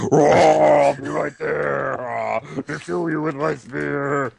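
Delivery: slow drawn-out villain voice; slow character voice. Retold or imitated. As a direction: draw it out like a game villain. Voicing a game character.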